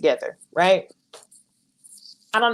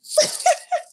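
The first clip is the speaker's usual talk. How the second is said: high laugh. Real, not acted. Laughing at Nell's energy remark.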